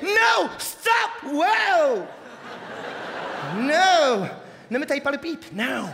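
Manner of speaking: put-on accent anglais chic; posh English accent. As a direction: play a posh Englishman in bed. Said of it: "c'est un Anglais, un mec chic".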